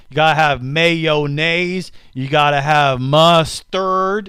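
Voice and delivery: slow, stretched-out put-on voice; funny bread voice. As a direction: drag out each food name like a silly chef. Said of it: "I gotta talk like that".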